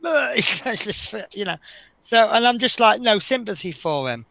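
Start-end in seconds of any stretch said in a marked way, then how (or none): 0.0-1.6 laughing through the words, slowed; can't get it out for laughing; real laughter breaking up the line. Real, not acted. Laughing at the door story.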